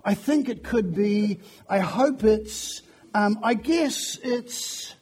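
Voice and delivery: slow, drawn-out tentative wavering; unsure, hesitant. Acted. Preacher imitating unsure believers asked about their faith, hesitant. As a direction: trail off unfinished, as if unsure of your belief. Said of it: "they'll use words like".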